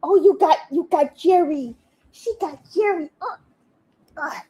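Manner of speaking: high delighted pet voice. Real, not acted. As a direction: coo praise at the dog. Praising dog for toy.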